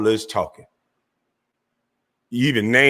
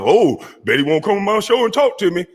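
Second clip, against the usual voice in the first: higher put-on voice of the rival; mocking imitation; 9 semitones higher than usual. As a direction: parody his tough talk. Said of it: "try to act tough".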